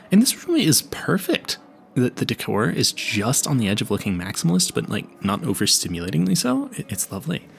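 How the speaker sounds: normal talking voice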